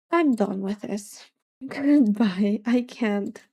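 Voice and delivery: usual speaking voice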